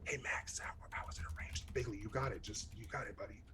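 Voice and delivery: soft unvoiced whisper aside; hushed off-mic whisper to a friend. Real, not acted. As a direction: whisper so the players can't hear.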